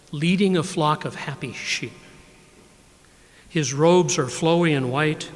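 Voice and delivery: measured, unhurried poem recital; poetry reading. Acted. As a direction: let each line land, then pause. Poet reads his poems.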